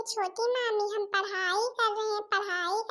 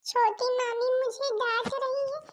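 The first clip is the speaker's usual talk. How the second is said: put-on higher childish whine, calling out to mother; child tattling with a whining cry; wailing complaint; 4 semitones higher than usual. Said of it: "इतनी जोर जोर चिल्लाना".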